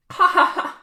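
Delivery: high, loud mocking cackle into taunt; triumphant mocking laughter; gloating laugh. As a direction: laugh in his face, then jeer. Gloating laugh at past self.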